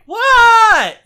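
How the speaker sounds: high, loud called-back what; shouted what. Retold or imitated. Kid answering the neighbor, loud high call. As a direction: yell back a reply. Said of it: "And I was like".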